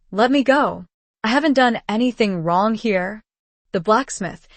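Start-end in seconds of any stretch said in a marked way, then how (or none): none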